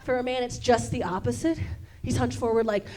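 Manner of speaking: ordinary talk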